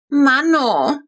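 put-on high, loud little girl's whine; a child complaining about the ruined toy; whining child. A narrator's reading. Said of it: "jammerte Flo".

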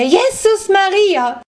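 very high, loud and slow, Jesus stretched into a wail; old woman's slowed, wailing scream; drawn-out hysterical shriek. A narrator's reading. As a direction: let the second Jesus Maria climb into a long panicked shriek. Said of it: "ganz hysterisch kreischen".

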